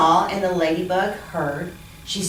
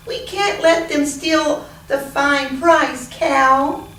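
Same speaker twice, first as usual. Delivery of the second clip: urgent; 7 semitones higher, 3 dB louder, slower than usual. Acted. Animals protest.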